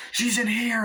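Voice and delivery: high-pitched alert to the others; acted call-out. Hunter alerting the family, acted call-out. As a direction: raise the alarm in imitation.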